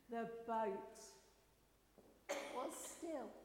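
slow, low, softened storytelling; hushed calm narration. A narrator's reading. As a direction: settle into stillness with long pauses. Storm calms, narrator hushes.

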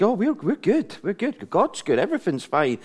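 put-on Sunday pleasantness; pretend all fine. Acted Sunday front of being fine.

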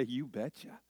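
soft, lightly voiced old dog; understated talking-dog voice. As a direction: answer as the dog, hushed and eager to play. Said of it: "he wags his tail, seemingly say".

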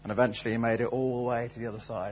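ordinary talk, nothing marked

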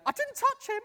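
high, loud, panicky "I didn't touch him"; high squeaky defensive voice; panicked woman. Retold or imitated. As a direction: imitate a flustered volunteer denying it. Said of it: "she went".

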